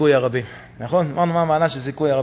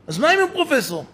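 much higher, exasperated repeat; pitched-up indignant retort; angry father; 11 semitones higher, faster than usual. Acted. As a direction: throw the question up in outrage. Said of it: "זה אבא התעצבן".

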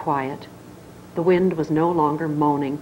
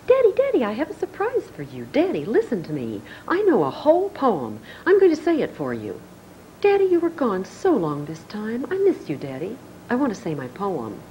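put-on higher, eager little girl; higher, eager child pleading; Maggie voice; 4 semitones higher than usual. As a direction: beg for Daddy's attention, bouncing high. Maggie voiced in soap scene.

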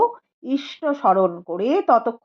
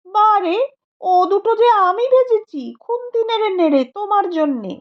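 put-on higher, lively young housewife; Malati's voice; 7 semitones higher than usual. A narrator's reading. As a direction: play her as eager and proud of her cooking. Young woman Malati speaking. (উঁচু গলায় উৎসুক তরুণী বৌঠান)

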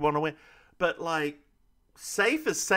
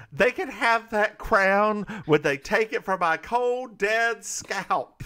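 higher-pitched declamation; mock defiance; 6 semitones higher, 4 dB louder, slower than usual. Queen refuses to give up her crown, higher-pitched mock defiance. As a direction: imitate a defiant stand-up speech.

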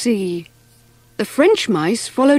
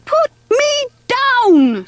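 high, drawn-out panicked cry; screaming; 13 semitones higher, slower than usual. A narrator's reading. Mouse dragged behind a speedboat, screaming. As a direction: cry out high, stretching each word. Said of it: "screamed Mervyn".

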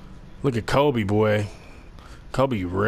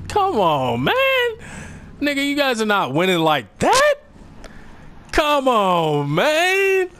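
gloating taunt; 13 semitones higher than usual. Real, not acted. Taunting opponents while winning.

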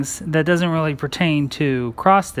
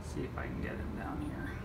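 whisper; 21 dB quieter than usual. Real, not acted. Coaxing bird on the balcony.

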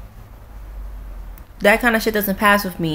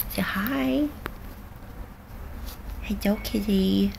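cooing to the cat; 2 semitones higher, 10 dB quieter, slower than usual. Real, not acted. Talking softly to her cat beside her, cooing. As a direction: coo gently at the kitty.

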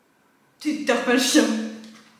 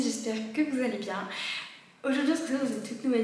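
speaking softly this time; softer; 8 dB quieter, faster than usual. Shown as a demonstration. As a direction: bring the greeting down gently. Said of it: "Parle doucement". (plus doucement)

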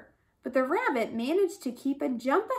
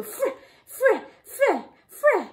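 digging sound; 10 semitones higher, 4 dB louder than usual. A narrator's reading.

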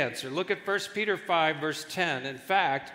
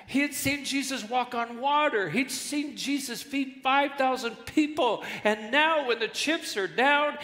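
much higher, impassioned preaching; preaching at full force; 7 semitones higher than usual. Acted. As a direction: lift the pitch and plead with Peter. Preacher rising in intensity.

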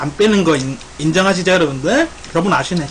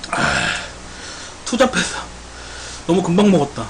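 ordinary delivery

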